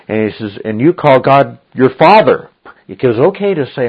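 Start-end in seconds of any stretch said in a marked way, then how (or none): 0.6-2.5 quoted challenge, loud and higher; potent accusation. Retold or imitated. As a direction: throw the quoted words out forcefully. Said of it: "What he said was so potent".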